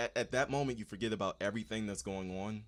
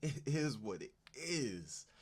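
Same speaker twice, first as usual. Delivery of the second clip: laughing; 6 semitones higher, much slower than usual. Real, not acted. Laughing repeat of catchphrase.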